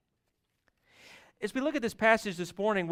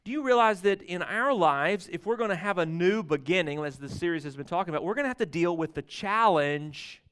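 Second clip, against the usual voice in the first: usual speaking voice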